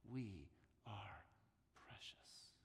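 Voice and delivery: whispered, breathy close. Shown as a demonstration. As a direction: let it fall to a whisper. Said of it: "hushed climax".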